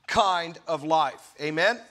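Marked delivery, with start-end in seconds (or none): none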